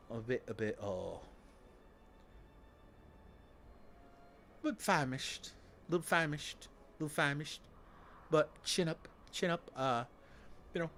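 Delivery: mock British accent, apologetic and stammering; lightly voiced polite Brit, stiff upper lip. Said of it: "British people".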